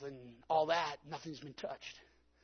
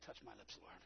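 soft, pleading murmur to the Lord; hushed prayer; 4 semitones lower, 12 dB quieter than usual. Shown as a demonstration. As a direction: breathe the prayer quietly and humbly. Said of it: "some of you ought to just say".

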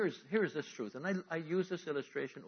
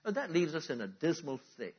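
ordinary talk, nothing marked

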